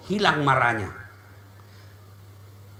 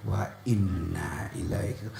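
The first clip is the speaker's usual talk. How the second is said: much lower, softer, slow recital; solemn recitation; 12 dB quieter, slower than usual. Shown as a demonstration. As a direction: murmur it low and slow. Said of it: "balik kanan". (bacaan rendah dan pelan)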